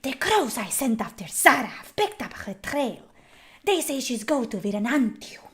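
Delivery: mock husky whisper, the end going breathless; game voice actor's delivery. Shown as a demonstration. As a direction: imitate the dubbed actor's hushed read. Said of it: "You don't say".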